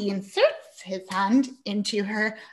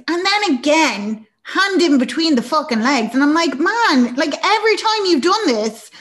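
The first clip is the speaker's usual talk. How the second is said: loud, high-pitched outraged exclaiming; raised voice, appalled and incredulous; exasperated rant; 8 semitones higher, 9 dB louder than usual. Real, not acted. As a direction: rant at the character in disbelief.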